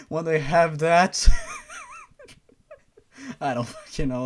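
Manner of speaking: laughing through the line; breaking into laughter. Real, not acted. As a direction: crack up mid-joke. Laughing breaks off his joke.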